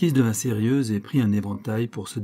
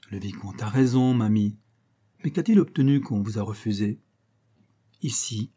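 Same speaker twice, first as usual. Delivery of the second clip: ordinary delivery, nothing marked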